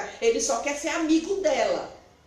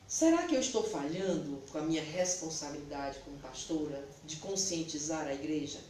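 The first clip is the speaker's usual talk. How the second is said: quiet prayer; 6 semitones lower, 6 dB quieter than usual. Retold or imitated. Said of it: "eu disse assim".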